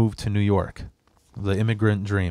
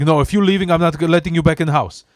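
higher, louder imitated scolding; mother's threat; 7 semitones higher, 6 dB louder, faster than usual. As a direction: lay down the law as an irritated mom. Acted mother's threat in quarrel.

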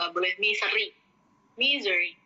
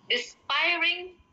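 "Despairing." drawn out, higher; slow, lifted "Despairing."; high slow correction; 9 semitones higher, much slower than usual. Shown as a demonstration. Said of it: "lebih tepatnya bukan membenci". ("Despairing." diucapkan pelan dan tinggi)

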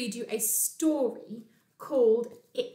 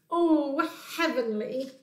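raised, swooning exclamation; blissful Bear; 4 semitones higher, slower than usual. Acted. Bear scratches his tummy on a log, blissful. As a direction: luxuriate in the relief.

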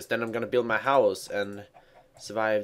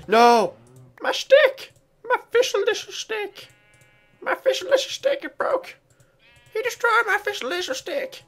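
squeaky falsetto wail over a broken stick, much higher; hammed-up shrieking despair, far higher than usual; mock squeal; 5 dB louder than usual.

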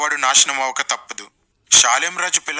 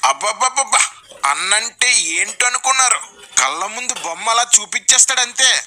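high-pitched mock admiration; gushing fan; 6 semitones higher than usual. Fan boasting about the preacher, mock gushing. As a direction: marvel like a starstruck follower, hammed up. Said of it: "మీ వాళ్ళు ఏం చెప్తారు?".

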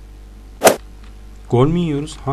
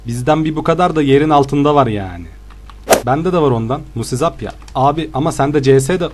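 usual speaking voice

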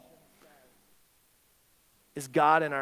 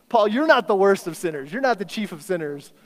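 louder, higher mock objection; play-argue emphatically with Paul; mock protest; 4 semitones higher, 8 dB louder than usual. Preacher jokingly corrects Paul on being worst sinner, mock protest.

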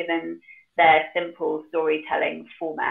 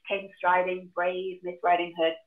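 normal talking voice

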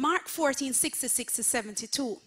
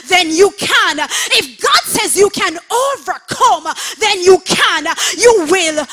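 much louder and higher, preaching at the top of the voice; full-force preaching; 4 semitones higher, 14 dB louder than usual. Acted. Pastor rallying online congregation to stand, rousing shouted preaching. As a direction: lift them up with a rousing, shouted charge.

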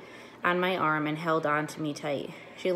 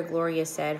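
ordinary delivery, nothing marked

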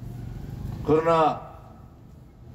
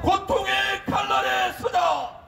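rally cry, shouted slow and high; roaring rallying speech; 11 semitones higher than usual. Real, not acted. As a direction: roar it to the crowd. Leader chants, crowd echoes.

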